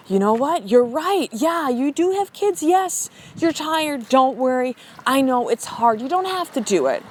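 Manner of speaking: high, drawn-out fake sympathy; mock placating. Friends placating someone who makes excuses, exaggerated high sympathy. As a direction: overdo the soothing, indulge every excuse. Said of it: "most people in your life that'll fucking be like".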